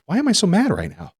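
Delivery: high-pitched, bewildered self-questioning; exasperated self-questioning. Retold or imitated. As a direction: question your own anger mid-argument. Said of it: "arguing and I'm like".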